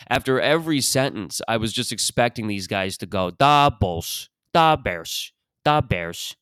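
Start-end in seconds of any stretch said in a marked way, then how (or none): 3.3-6.4 put-on punchy Chicago sports-fan accent; Bill Swerski's superfans. Superfans saluting their teams after every sentence, Chicago accent. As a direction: hit each team name with a Chicago honk. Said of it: "it's like Bill Swerski's superfans".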